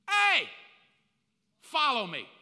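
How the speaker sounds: high, loud call to follow. Pretend. Jesus calling his first disciples, loud and high. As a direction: summon them boldly. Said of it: "His first thing he does".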